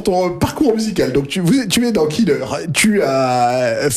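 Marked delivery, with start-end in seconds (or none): none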